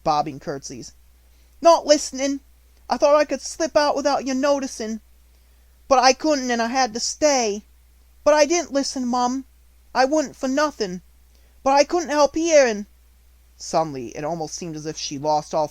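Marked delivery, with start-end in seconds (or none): 1.6-12.9 much higher, louder tearful pleading; desperate to be believed; pleading maid. A narrator's reading. Maid pleading innocence to the headmistress, high and tearful.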